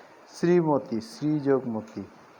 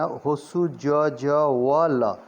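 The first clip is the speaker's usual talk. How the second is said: letter by letter, each sound drawn out; spelled out slowly; much slower than usual. Shown as a demonstration. Teacher spells out Ujjwal for learners, slowly letter by letter. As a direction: stretch and separate every letter. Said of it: "उज्जवल का ध्यान से देखना".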